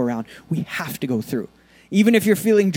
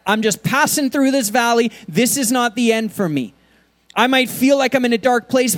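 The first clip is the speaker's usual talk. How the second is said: rousing sermon build; preaching at full force; 5 semitones higher than usual. Acted. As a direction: lift the church up, pressing on.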